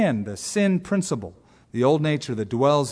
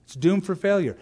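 normal talking voice

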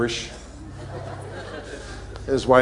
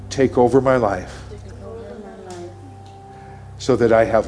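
leading a prayer. Real, not acted. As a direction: pray it gently for the listeners to repeat. Pastor leads salvation prayer.

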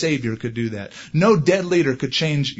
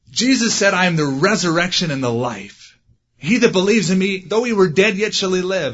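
beaming preacher quoting scripture, raised high and loud; smiling preacher; 5 semitones higher, 4 dB louder than usual. Retold or imitated. Pastor at the funeral beams at the mourners, high and loud. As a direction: preach it with a big football coach smile.

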